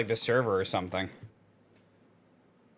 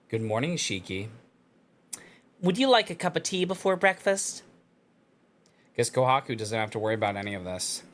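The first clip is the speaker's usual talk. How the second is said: story narration. Acted. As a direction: read it like a novel narrator. Reads visual novel text aloud.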